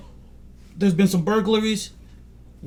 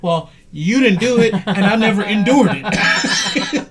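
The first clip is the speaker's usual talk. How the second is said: higher, louder, breaking into laughter; amused retold retort; 5 semitones higher, 9 dB louder than usual. Friend answering the pastor's slavery apology, laughing and loud. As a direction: toss the quote out loudly through a laugh.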